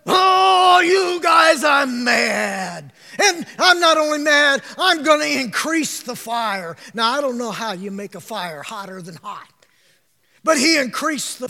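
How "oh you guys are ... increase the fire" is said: slow, loud furious outburst; furious king. Retold or imitated. Preacher voicing the raging king at the youths, loud anger. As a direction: roar it like an enraged king.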